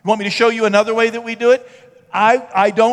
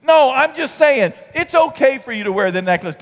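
ordinary talk, nothing marked

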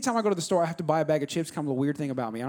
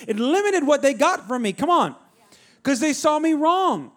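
rising, louder preaching voice; 5 semitones higher, 3 dB louder than usual. Real, not acted. Pastor building to the sermon's point, preaching harder. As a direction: drive the point home to the church.

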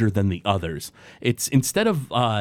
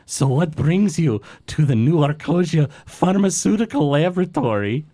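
kobold scientist; 5 semitones higher than usual. Acted. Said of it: "He says".

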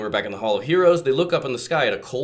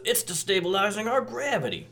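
higher character voice; 8 semitones higher, 4 dB quieter than usual. Retold or imitated. Cliffjumper quote, first take.

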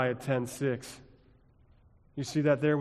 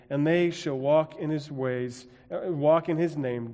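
measured, raised reading of scripture; 3 semitones higher, 4 dB louder than usual. Acted. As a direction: read it out like holy writ.

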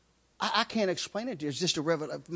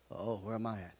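scared mutter; 11 semitones lower, 12 dB quieter than usual. Retold or imitated. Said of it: "fear hit me".

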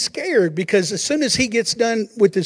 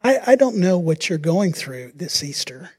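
ordinary talk

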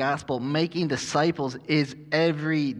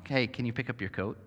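put-on soft, polite ask to a child; a gentle question to your kid about the coat; polite request; 3 semitones lower, 7 dB quieter than usual. Parent asking a child politely. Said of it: "Which is really polite saying".

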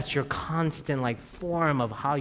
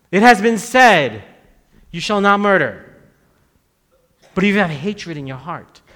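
preached proclamation; 4 semitones higher, 11 dB louder than usual. Acted. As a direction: proclaim the commandment to the hall. Preacher quoting commandment.

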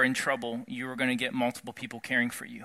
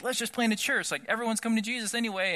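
much higher, quick, carefree voice; breezy naive excitement; 8 semitones higher, faster than usual. Retold or imitated. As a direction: sound overconfident and carefree. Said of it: "and we're like".

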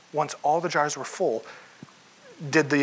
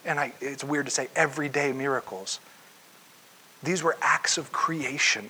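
ordinary talk

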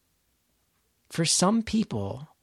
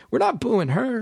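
urgent plea; 9 semitones higher, 4 dB louder than usual. Retold or imitated. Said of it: "And then I'm also going".

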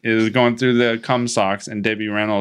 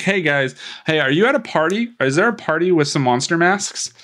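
chirpy mock Marnie; 7 semitones higher than usual. Marnie voiced in retelling.

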